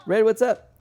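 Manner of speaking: excited greeting. Real, not acted. Greets viewer Red again.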